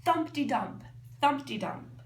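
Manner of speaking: usual speaking voice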